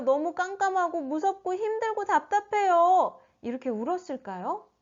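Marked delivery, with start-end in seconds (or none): 0.0-3.2 higher-pitched pretend wail; mock crying. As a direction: whine as if about to cry. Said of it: "막 울었을까요?". (우는 척 징징대는 말투)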